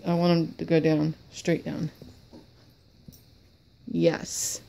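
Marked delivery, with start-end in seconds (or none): none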